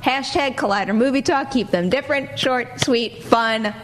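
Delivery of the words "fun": usual speaking voice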